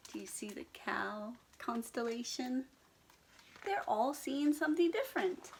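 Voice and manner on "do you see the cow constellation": plain reading voice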